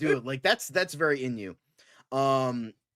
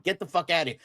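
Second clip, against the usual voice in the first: ordinary talk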